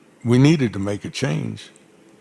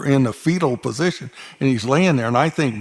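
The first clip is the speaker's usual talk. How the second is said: normal talking voice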